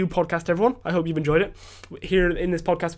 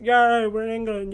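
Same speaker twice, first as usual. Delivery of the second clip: higher, slower, put-on cheerful yay; mock cheer; 6 semitones higher, 3 dB louder, slower than usual. Crossing the border into England, mock cheering. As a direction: cheer like a tourist at the sign. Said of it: "and it's like".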